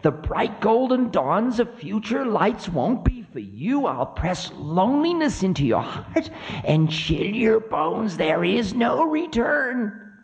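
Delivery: high, menacing voice of Time; high vengeful threat, slowing at the end; cruel Time. Acted. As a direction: gloat over a beaten enemy, pitched high. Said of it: "took on the cruel look".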